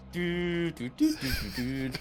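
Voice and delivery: high sung-out modem beeps; dial-up handshake imitation. Joking about nineties internet, imitated dial-up tones. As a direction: mimic an old modem dialling in high toots. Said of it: "do, like, the handshake dial-up".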